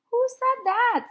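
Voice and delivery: sharp high startled question. A narrator's reading. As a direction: voice jumps high in surprise. Stonewall Jackson startled.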